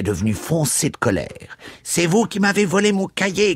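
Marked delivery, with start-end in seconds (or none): 1.8-3.5 high, accusing cry with stress on vous; retold angry cry. Priest retelling the boy's accusing cry, high and angry. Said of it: "criait-il".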